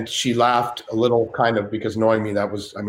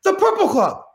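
much higher, louder put-on De Niro; De Niro remembering; 4 dB louder than usual. De Niro suddenly remembering the club, much higher and louder. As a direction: recall it with a big lift. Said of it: "he goes".

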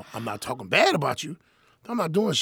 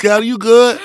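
high, loud comic reassurance; animated calming down; 10 semitones higher, 15 dB louder than usual. Retold or imitated. Comedian re-enacting calming his crying friend, loud and high. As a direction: exclaim it, pitched way up. Acting how he calmed her down.

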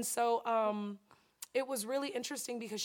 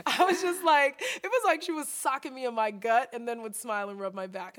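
animated recounting; 7 dB louder than usual. Real, not acted. Laughing recounting.

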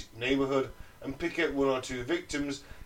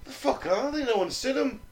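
raised, worried local asking around; local sailor voice; 8 semitones higher, 4 dB louder than usual. Retold or imitated. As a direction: call out like a puzzled dockside local.

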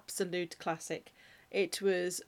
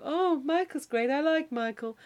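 quoting sister's line; 6 semitones higher than usual. Retold or imitated. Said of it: "Becca would go".

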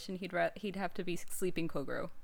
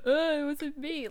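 slightly hammed-up whiny denial, higher and drawn out; mock bumbling detective; 6 semitones higher, 4 dB louder than usual. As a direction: imitate a hapless bumbler, slowly. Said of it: "he goes from this very eloquent".

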